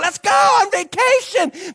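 high, slow happy singsong; giddy disciples. Pretend. Disciples rowing away from crowds, high happy singsong. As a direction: sing it out as they row off.

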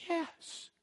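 tiny, high, very soft voice; timid mock reply. Girl answering a man on the street, timid hushed reply. Retold girl's tentative answer.

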